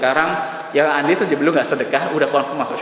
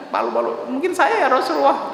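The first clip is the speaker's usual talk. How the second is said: timid answer; 10 semitones higher than usual. Acted. Ulbah timidly answers the Prophet.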